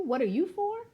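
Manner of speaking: ordinary delivery, nothing marked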